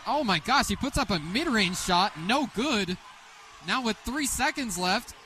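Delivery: ordinary talk, nothing marked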